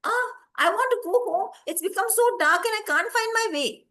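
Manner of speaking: put-on high child's voice, complaining of trouble; pleading lost boy. Lost boy explains his trouble to insects, high pleading voice.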